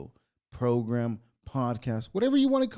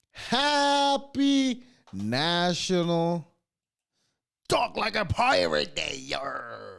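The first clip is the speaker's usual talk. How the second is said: slow, drawn-out hammed-up pirate growl; 5 semitones higher, much slower than usual. Host greeting listeners on pirate day, hammed-up pirate voice. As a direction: ham it up like an old sea dog. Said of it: "We're gonna talk like pirates today".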